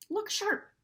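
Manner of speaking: high urgent call; shout. Acted. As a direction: call out the warning sharply. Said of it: "shouted Edmund".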